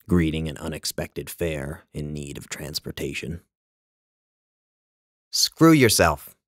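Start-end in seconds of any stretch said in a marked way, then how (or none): 5.3-6.4 loud, drawn-out, high brush-off; slow, loud dismissal; tossed over the shoulder. A narrator's reading. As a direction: lob the insult loudly behind you. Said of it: "she lobs over her shoulder".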